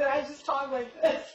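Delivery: ordinary talk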